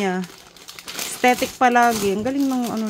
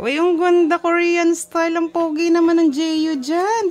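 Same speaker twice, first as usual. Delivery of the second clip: excited; 6 semitones higher, 6 dB louder than usual. Real, not acted. Parent praising baby's pants.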